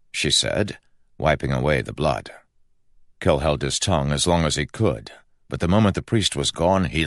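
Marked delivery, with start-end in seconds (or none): none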